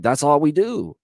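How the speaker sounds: ordinary delivery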